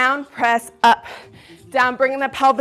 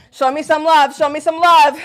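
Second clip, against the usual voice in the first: higher, louder hype call; rallying cheer; 4 semitones higher, 6 dB louder than usual. Real, not acted. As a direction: rouse the class to cheer back.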